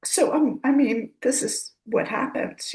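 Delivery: high, near tears, holding it together; voice breaking. Real, not acted. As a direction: let the voice crack with real grief. Grieving over her parents.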